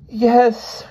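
put-on high, unhappy little girl; reluctant child's confession; miserable. A narrator's reading. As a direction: admit it wretchedly, pitched high. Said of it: "she said miserably".

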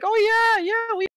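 high, loud, hammed-up fake bragging; mock lying strategist. Mocking strategists who inflate their averages, high hammy bragging. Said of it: "who'll be like".

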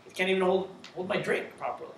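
disgusted grumble. Retold or imitated. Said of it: "You'd be like".

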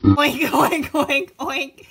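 high, loud playful oinking; loud pig oinks. Pretend. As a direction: oink like a piggy for a baby. Pig noise for toddler.

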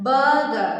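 ബർഗർ said "buh-guh", the r sounds dropped. Shown as a demonstration. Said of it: "ആറിന്റെ sound അതിനകത്ത് വരേണ്ട കാര്യമില്ല".

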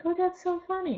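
high, lightly gushing mock voice; mock fan gush. Vlogger mimics people on comps, high mock gush. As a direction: toss it off high like a fake-delighted fan. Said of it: "they're all like".

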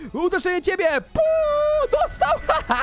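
very high, whooping strike call; hyped-up play-fight glee; gleeful attack. Pretend. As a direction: squeal in triumph with a laugh. Landing a hit in play.

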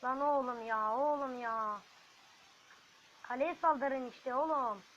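loud, frustrated shouting; real annoyed outburst; exasperated yell. Real, not acted. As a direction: scold the troops, dragging the last line slowly. Exasperated at troops not attacking.

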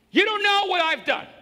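loud, high anguished protest; despairing cry. Retold or imitated. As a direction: throw it at the pastor in despair. Voicing despairing sinner to pastor.

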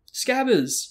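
high, loud call after a runaway pet; shouting a name. A narrator's reading. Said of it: "Ron shouted".

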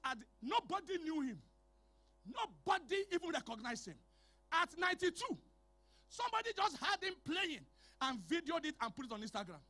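ordinary talk

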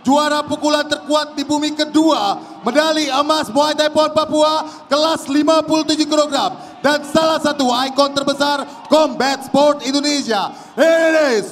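booming, drawn-out fighter introduction; ring announcer. Acted. (pembawa acara ring yang menggelegar)

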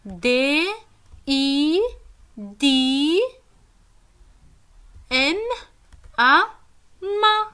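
each letter of D, I, M, A said slowly and apart; spelling aloud. Shown as a demonstration. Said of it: "Comment j'écris Dima?". (épeler lettre par lettre)

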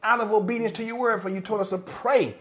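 rising into full-force preaching prayer. Acted. Pastor praying over the congregation, fervent and building. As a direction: pray it out, building to loud conviction.

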